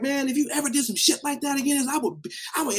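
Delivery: high-pitched acted threat; retold yelling. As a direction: imitate an angry performer warning someone off. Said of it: "I'm getting yelled at by [name]".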